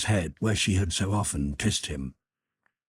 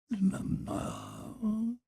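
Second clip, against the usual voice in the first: sleepy mutter; 7 dB quieter than usual. A narrator's reading. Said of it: "he muttered".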